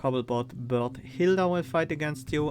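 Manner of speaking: usual speaking voice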